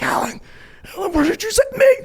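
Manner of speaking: high, loud panicked phone yell; hammed-up frantic complaint; mock panic. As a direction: cry out in exaggerated alarm. Retells panicked phone call to Alan.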